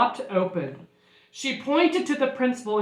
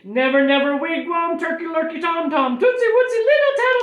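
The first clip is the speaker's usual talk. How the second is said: raised chant climbing to a squeal; silly storybook rattle, ending very high; giddy word list; 7 semitones higher, 6 dB louder than usual. Acted. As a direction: climb higher and higher to the end. Teacher's nonsense list winds on.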